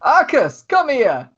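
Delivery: high, loving summons for a hug; affectionate Tesla. Acted. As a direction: open your arms with your voice. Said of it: "So much love is coming off Tesla".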